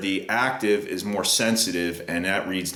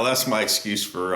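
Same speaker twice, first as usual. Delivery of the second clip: ordinary talk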